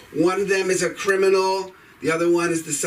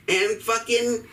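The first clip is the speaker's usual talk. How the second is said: usual speaking voice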